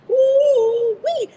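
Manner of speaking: loud, high ooh-wee; delighted whoop. Real, not acted. Coach whoops about a company that listens. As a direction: let the joy burst out.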